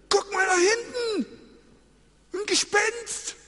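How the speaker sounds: high, loud cry of alarm; panicked shout. Retold or imitated. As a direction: yell out pointing at a ghost. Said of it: "schreit der eine".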